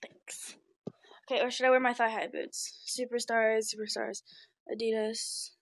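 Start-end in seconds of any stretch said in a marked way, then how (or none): 0.0-0.6 whispering. Real, not acted. Quiet thanks aside.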